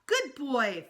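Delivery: put-on high, loud little-kid echo; toddler parroting. A narrator's reading. Little brother parroting his mother, high and loud. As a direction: repeat it like a toddler.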